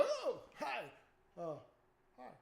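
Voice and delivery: high, startled little hello; startled greeting. Pretend. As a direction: greet the spirit in a nervous squeak. Imagined greeting to a spirit.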